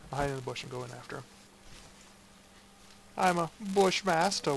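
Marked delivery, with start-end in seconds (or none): 3.2-4.6 grand boast. Acted. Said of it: "one might say".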